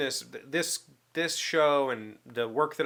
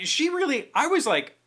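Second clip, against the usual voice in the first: ordinary talk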